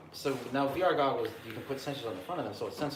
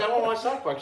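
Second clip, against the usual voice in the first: put-on kid voice; 10 semitones higher, 7 dB louder, faster than usual. Retold child asking mom.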